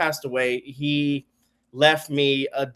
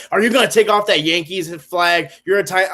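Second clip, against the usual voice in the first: louder, higher mock badgering; hounding; 4 semitones higher, 7 dB louder than usual. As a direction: imitate pushy fans hounding you. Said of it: "people were hounding me like".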